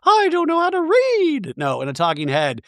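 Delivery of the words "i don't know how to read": very high-pitched mock-chirpy caricature; ditzy reality-star send-up, far above normal pitch; Dorit. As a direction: squeal it out in a silly falsetto. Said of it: "And Dorit's like".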